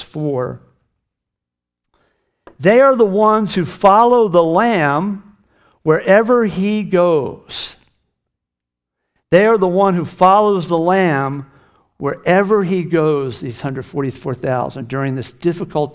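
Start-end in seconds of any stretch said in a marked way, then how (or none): 2.6-13.4 loud, raised, deliberate preaching voice; ringing pulpit declaration of a Bible verse; emphatic scripture reading. Acted. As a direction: proclaim the verse slowly and forcefully. Reads the verse aloud emphatically.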